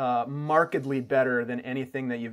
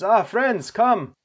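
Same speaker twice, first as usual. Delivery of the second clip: loud, higher-pitched hearty host voice; welcoming host; 5 semitones higher, 8 dB louder than usual. Acted. Game master as camp host greeting approaching guests, loud and high. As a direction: greet newcomers loudly, voice lifted. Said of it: "comes over and says".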